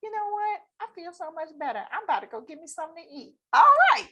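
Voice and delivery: relieved client. Retold or imitated. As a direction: play the client suddenly feeling better. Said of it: "client relieved".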